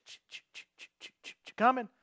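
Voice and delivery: whispered ch- ch- of deer steps in the leaves; imitated footsteps. Preacher mimics a deer creeping close, whispered. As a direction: breathe out the crunch of hooves, softly.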